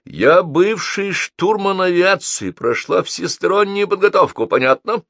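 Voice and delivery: high, forceful self-assertion; indignant outcry. A narrator's reading. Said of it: "вскричала бабушка".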